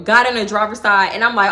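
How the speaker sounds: ordinary talk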